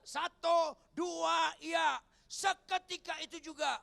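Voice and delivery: high, very loud count-in; shouted cue. Real, not acted. Preacher leads congregation reading.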